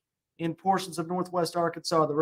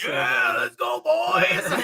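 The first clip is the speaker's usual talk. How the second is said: frat shout; 13 semitones higher, 5 dB louder, much slower than usual. Real, not acted. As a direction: whoop it like a frat party. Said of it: "you all remind me of a frat house".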